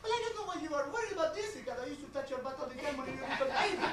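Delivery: put-on high-pitched accented mom voice; fussing mother. Mother dismissing her grown son's prison worry, fussing.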